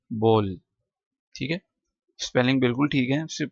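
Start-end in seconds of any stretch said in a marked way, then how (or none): 0.1-0.6 बोल said with the English "bowl" vowel; बोल, long o like bowl; English bol. Shown as a demonstration. Teacher correcting students: बोल like bowl, not baal. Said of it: "ठीक है, बाल नहीं".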